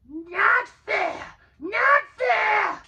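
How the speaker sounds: high, loud creature shout; high, loud creature outcry; Gollum hissing protest. A narrator's reading. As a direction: howl at the unfairness. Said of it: "he hissed".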